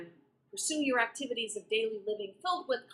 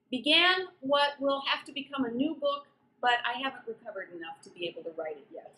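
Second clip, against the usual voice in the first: usual speaking voice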